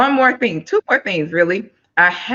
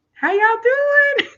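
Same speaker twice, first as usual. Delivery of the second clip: squealed, much higher, slow call to the viewers; a high, drawn-out hello to everyone watching; excited sing-song greeting; slower than usual. Real, not acted. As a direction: stretch it out like a happy holler. Host calls out greeting to viewers.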